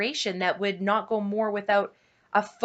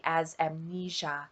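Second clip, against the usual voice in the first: usual speaking voice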